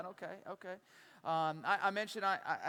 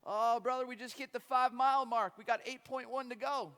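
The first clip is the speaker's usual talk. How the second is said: put-on louder, cheerful older man's reply; cheery old runner; 6 semitones higher, 7 dB louder than usual. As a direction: breezily tell him the bad news. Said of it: "and he goes".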